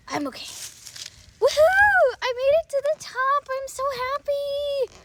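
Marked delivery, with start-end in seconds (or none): 1.4-5.0 high, loud whoop of triumph; cheering. Acted. Young explorer reaching the mountain top, cheering high and loud. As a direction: cheer at the summit.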